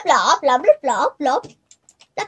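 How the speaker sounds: normal talking voice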